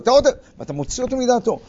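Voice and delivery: ordinary delivery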